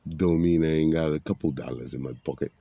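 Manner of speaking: ordinary delivery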